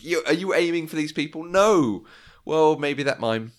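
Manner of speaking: tossing lines back and forth in character; acted dialogue. Acted car dialogue retold.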